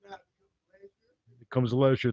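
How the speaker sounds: leisure drawn out slow as LEH-zhur; rich man's leisure. Shown as a demonstration. Comic shows how billionaires say leisure, slow and savouring. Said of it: "when you get billions".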